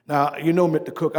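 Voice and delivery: usual speaking voice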